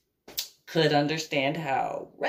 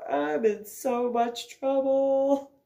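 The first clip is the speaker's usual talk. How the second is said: dread; 6 semitones higher, slower than usual. Real, not acted. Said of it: "I am so".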